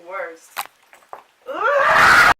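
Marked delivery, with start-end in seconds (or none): 1.5-2.3 loud, high fed-up "Ugh!"; exasperated yell; frustrated shout of disgust. Real, not acted. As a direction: blurt out your disgust at the snow. Hating the snow, frustrated outburst.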